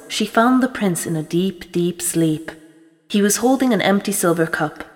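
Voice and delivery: usual speaking voice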